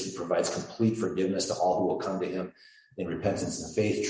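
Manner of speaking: ordinary delivery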